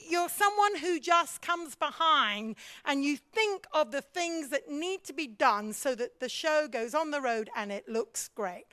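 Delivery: normal talking voice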